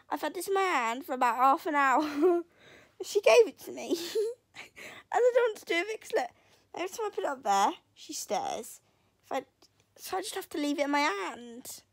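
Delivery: ordinary talk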